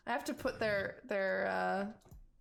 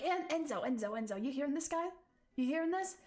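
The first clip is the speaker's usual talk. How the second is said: thug chatter; 5 semitones higher, faster than usual. Acted. GM voicing a thug's chatter.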